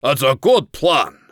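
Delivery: loud gruff bark. A narrator's reading. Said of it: "Rogar barked".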